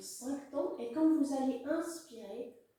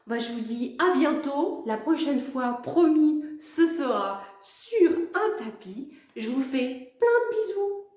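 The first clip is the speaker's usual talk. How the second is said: cheerful goodbye; 3 semitones higher, 10 dB louder than usual. Real, not acted.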